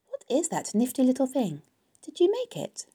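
high, eager piglet voice; curious Wilbur. A narrator's reading. Wilbur spots the strange sack, curious and high. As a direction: ask with wide-open curiosity, pitch climbing.

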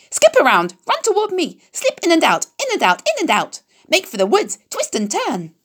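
very high, sing-song goose call; honking goose cry. A narrator's reading. Goose urging Wilbur to dodge, high honking cry. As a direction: squawk the orders like a flustered goose. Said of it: "cried the goose".

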